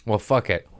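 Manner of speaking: ordinary talk, nothing marked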